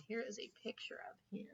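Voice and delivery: breathy aside, barely voiced; soft whispered aside to listeners. Real, not acted. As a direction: whisper it off-mic. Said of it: "unvoiced whisper".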